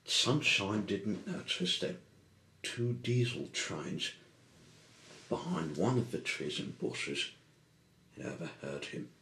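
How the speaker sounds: ordinary delivery, nothing marked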